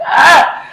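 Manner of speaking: a sudden loud excited yelp; high happy yelp; excited shriek. Real, not acted. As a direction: squeal with delight at the song. Excited about favourite song.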